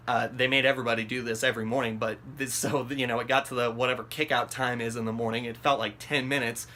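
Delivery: ordinary delivery, nothing marked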